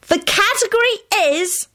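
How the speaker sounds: high, slow build-up of suspense; drawn-out high teaser; dramatic announcement. A narrator's reading. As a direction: announce it high, stretching it out before a pause. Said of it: "Teddy paused dramatically".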